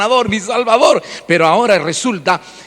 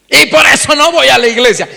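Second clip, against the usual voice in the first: mock indignant excuse; 7 semitones higher, 7 dB louder than usual. Voices excuse of churchgoer.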